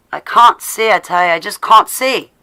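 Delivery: loud, high, hammed-up lament; over-the-top stage despair with affected vowels; mock melodrama. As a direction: wail it theatrically, playing it for laughs. Repeats it as a melodramatic joke.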